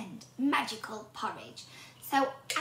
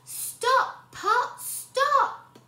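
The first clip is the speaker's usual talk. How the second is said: high, loud commanding shout; shouting the spell; 13 semitones higher, 9 dB louder, much slower than usual. A narrator's reading. Hannah shouting the stop words at the pot. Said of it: "Hannah shouted the magic words".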